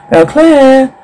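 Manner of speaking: names called in a high voice; calling out. Acted. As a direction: call them high through a door. Calling through a locked door.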